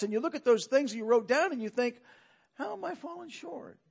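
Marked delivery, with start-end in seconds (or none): 2.5-3.8 soft, high, hushed wondering; quiet self-questioning. Acted. Preacher voicing a listener's private thought at confession, quiet self-questioning. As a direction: ask yourself softly, pitched up. Question put to oneself in reflection.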